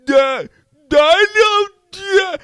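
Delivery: loud, high, hammed-up dim-witted grunts; mock a slow dumb guy, loud and high; cartoonish "duh" imitation. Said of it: "You don't have to be going".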